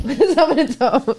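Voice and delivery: slow words broken up by laughter; laughing. Real, not acted. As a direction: giggle through the words. Cracks up repeating own remark.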